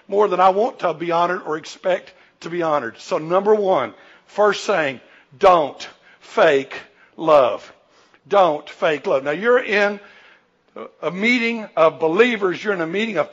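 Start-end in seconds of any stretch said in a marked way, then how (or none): 5.4-7.7 very slow, every word weighted; preacher driving home point one, very slow; slow emphatic point. Real, not acted. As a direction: drag out don't fake love. First point of the sermon.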